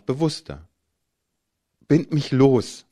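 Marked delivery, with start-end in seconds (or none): 1.9-2.8 loud, sharp demand. A narrator's reading. Tied up man demanding his boyfriend free him, loud demand. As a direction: insist he unties you now, loud. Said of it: "forderte ich".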